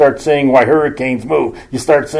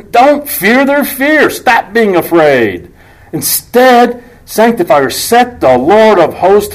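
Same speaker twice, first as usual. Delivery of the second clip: high, slow exhortation; preaching; 6 semitones higher, slower than usual. Real, not acted. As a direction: urge them slowly from up high.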